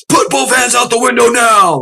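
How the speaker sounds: high barked order; yelling commands. Retold or imitated. Trooper yelling at the driver, high barked command. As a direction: shout the command like a tense cop. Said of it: "starts yelling fucking commands at me".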